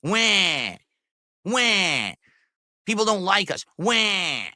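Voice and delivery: mock baby wail, whiny; mock crying wah. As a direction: fake crybaby wah sounds. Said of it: "whine and complain about it".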